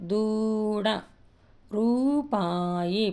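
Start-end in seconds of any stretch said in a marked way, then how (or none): 1.7-3.0 రూపాయి very slow, రూ drawn out; long oo, stretched. Shown as a demonstration. Teacher stretching రూపాయి to show the long vowel. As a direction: drag out రూ in రూపాయి. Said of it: "దీర్ఘం పొడుగ్గా".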